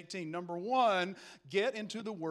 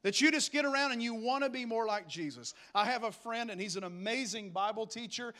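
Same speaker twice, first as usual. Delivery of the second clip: ordinary delivery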